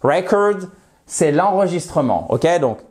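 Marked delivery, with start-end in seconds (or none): 0.0-0.7 "Record" as the noun REC-ord, open e; stress on first syllable. Shown as a demonstration. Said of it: "l'accentuation sur la première syllabe". (« Record » accentué sur la première syllabe, e ouvert)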